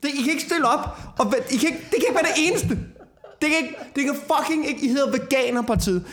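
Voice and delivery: high, laughing, exasperated ranting. Real, not acted. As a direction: rant at them half laughing, in disbelief. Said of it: "færdig med mit rant".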